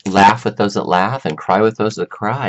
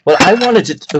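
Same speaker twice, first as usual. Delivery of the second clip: startled outburst; 11 semitones higher, 7 dB louder than usual. Real, not acted. Water spills on keyboard.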